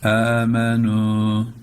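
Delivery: "Amenu" with a light open a, not a flat a, sung slowly; open a sound. Shown as a demonstration. Teacher models "Amenu", slow sung a. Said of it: "A demeyelim".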